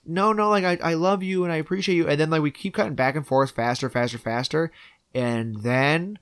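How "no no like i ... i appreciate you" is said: high, hurried mock pleading; retold frantic mother. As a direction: imitate a mother rushing to take it back. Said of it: "And she's like".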